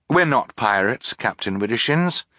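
usual speaking voice

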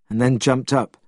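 usual speaking voice

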